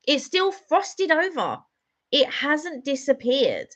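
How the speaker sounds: high, drawn-out amazement; slow, high-pitched incredulous complaint; real disbelief. Real, not acted. Disbelief at lingering frost.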